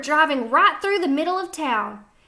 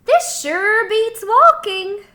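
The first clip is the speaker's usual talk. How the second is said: pigs' thought; 8 semitones higher, 7 dB louder, slower than usual. A narrator's reading. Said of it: "what they thought was".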